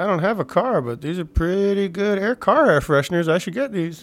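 high-pitched shopper reasoning aloud; put-on high voice of a bargain hunter; tempted bargain buyer.

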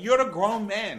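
high, loud imitation of a thick Jamaican accent. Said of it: "her accent gets real thick".

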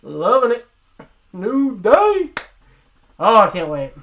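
loud, high hype shout; excited. Real, not acted. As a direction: cheer it like a hyped fan. Hyping New Day.

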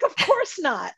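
high, incredulous refusal; mock indignation. Retold refusal of absurd suggestion.

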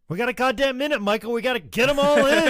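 high-pitched, hammed-up urgency; urgent pleading. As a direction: rush them along like time is running out. Acted wrestler rushing a short match.

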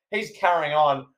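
normal talking voice

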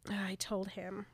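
hushed retold quote; whisper. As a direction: say it under your breath.

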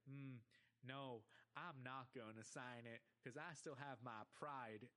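put-on slow, deliberate drawling accent; Stinky's drawl. Cartoon kid refusing to sign a contract, slow drawl. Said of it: "he speaks in a drawl".

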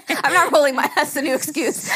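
laughing denial. Real, not acted. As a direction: protest while cracking up. Denying she rolled eyes, laughing.

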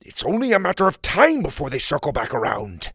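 loud, high furious scolding; an enraged father bellowing her name; angry father shout. Acted. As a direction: yell at the daughter who took the airship. Father scolds daughter angrily.

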